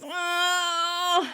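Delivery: long, high pained howl; imitated drawn-out wail of pain; mock howl of pain. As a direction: howl it out, hammed-up. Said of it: "Joey howls in pain".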